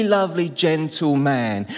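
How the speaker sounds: ordinary talk, nothing marked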